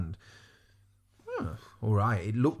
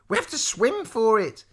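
put-on high, louder girl's voice; urgent insistence; 10 semitones higher, 5 dB louder than usual. A narrator's reading. Eliza urging Lavender to swim, high and insistent. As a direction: press the point urgently, pitched up.

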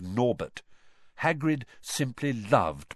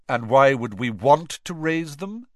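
cold; 5 semitones higher, 6 dB louder than usual. A narrator's reading. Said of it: "said a cold voice".

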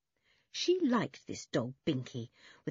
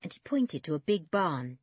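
ordinary delivery

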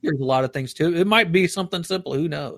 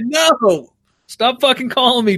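high, exasperated protest; mock exasperation; 7 semitones higher, slower than usual. Man fed up with automated sales calls, high mock exasperation. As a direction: re-enact snapping at unwanted callers. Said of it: "I'm like".

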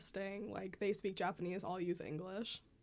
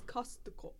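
"Costco" in a Japanese accent; 2 semitones higher than usual. Shown as a demonstration. Teacher shows how Costco is said in Japan, Japanese accent. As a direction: say "Costco" with Japanese syllables, Kosutoko. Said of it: "say it in a Japanese accent".

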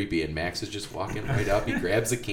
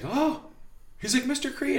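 put-on much higher boyish greeting; high kid voice. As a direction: squeak it out like an excited boy. Former student voiced in story.

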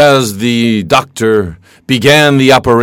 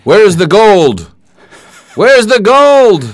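mock demand; 9 semitones higher than usual. Student acts demanding interrogator.

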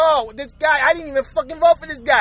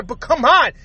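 usual speaking voice

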